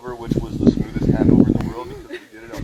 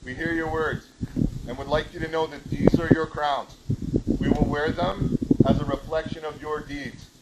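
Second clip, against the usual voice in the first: ceremonial royal address, rising louder; formal proclamation; 5 semitones higher, 7 dB louder than usual. Acted. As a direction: proclaim it to the court.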